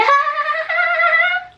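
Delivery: long shrieking evil laugh; witch cackle. Acted. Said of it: "a old stereotypical witch".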